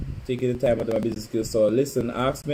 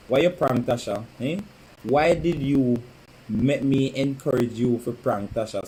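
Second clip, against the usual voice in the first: normal talking voice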